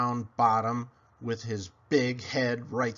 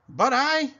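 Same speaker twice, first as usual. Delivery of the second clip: startled protest; 11 semitones higher, 7 dB louder than usual. Acted. Pig protests.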